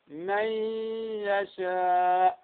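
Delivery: slow "من يشاء", ن becoming a two-count nasal ي; Ghunna-Demo. Shown as a demonstration. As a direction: draw out "من يشاء" as nasal "mayy-yashaa" with a long final vowel. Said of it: "بمقدار ماذا؟ حركتين".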